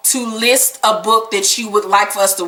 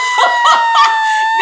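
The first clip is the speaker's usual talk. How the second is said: real shrieking laughter; high shrieking laugh. Real, not acted. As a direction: burst into a squealing laugh. Laughing at own impression.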